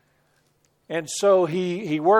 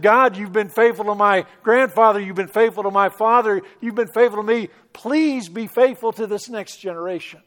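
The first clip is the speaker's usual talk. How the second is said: higher, louder desperate plea; crying out to God; 4 semitones higher, 6 dB louder than usual. Retold or imitated. Son in the car crying out to God, loud and high. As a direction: beg God from the gut. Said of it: "he cried out and said".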